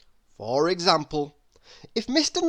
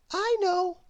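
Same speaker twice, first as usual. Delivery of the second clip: baker woman voice; 13 semitones higher than usual. Acted. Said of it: "said Missus Crumb".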